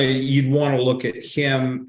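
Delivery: ordinary talk, nothing marked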